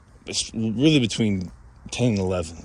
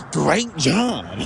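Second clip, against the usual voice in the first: imitating a delighted boss, swooping high; hammed-up, higher-pitched congratulation; ecstatic praise; 7 semitones higher than usual. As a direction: gush with approval, playing the thrilled boss. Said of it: "She was, like, ecstatic".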